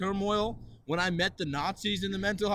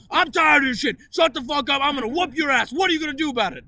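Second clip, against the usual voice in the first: loud, high mock threat; threatening yell; 9 semitones higher, 10 dB louder than usual. Imitating the angry patient threatening him, yelling. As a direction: bark it like a bully. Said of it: "eventually they go".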